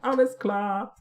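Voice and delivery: high, exaggerated German accent; hammed-up German, pitched high; mock German accent. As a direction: chirp it in comic German. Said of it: "probably an offensive German accent".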